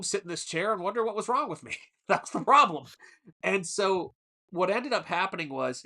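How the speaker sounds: usual speaking voice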